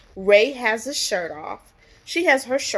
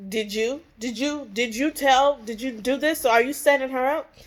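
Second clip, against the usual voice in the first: higher-pitched, halting retold interrogation; mock suspicious questioning; 5 semitones higher than usual. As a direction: act out a brother demanding an answer. Acts out Bobby questioning J R.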